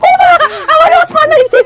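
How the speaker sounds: mother crying out in delight; mother thrilled her son is home; excited mother. Acted. Mother excited at son's return.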